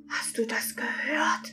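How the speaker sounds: breathy secret whisper. Acted. Sprite whispering to the narrator about a noise. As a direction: whisper it warily.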